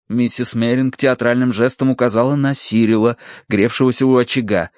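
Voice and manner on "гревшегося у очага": usual speaking voice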